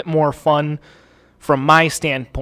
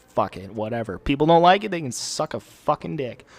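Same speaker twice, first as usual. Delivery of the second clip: normal talking voice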